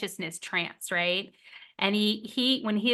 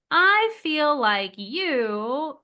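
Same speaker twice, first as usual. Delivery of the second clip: accusing example; 5 semitones higher, 3 dB louder, much slower than usual. Shown as a demonstration.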